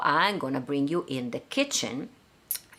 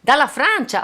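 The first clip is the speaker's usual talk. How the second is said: excited repeat; 9 semitones higher, 9 dB louder than usual. Real, not acted. Host repeats the prompt eagerly.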